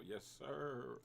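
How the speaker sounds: drawn-out, slow and smug; slow flexing yes sir. Real, not acted. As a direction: savor the win, stretching it out. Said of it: "Gotta flex a little".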